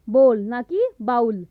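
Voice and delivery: normal talking voice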